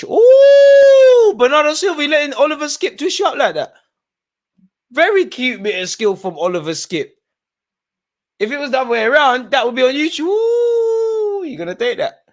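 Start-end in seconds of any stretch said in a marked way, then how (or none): 0.0-3.7 excited outburst. Real, not acted. Fan reacting to missed chance live. 8.4-12.2 excited ranting. Real, not acted. Fan mocking the missed move.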